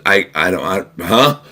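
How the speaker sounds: imitating a stammering, baffled son; dumbfounded disbelief. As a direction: stammer in mild disbelief, ending on a puzzled "huh?". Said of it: "he could not believe it".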